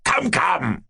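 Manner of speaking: high-pitched, impatient royal prodding. A narrator's reading. King prodding the jester, impatient. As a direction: hurry him along testily. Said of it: "said the king impatiently".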